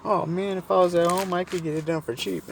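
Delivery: high-pitched impression of the woman; imitated woman. Stranded woman wishing she were home, imitated high. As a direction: lift the pitch high as her. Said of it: "And she goes".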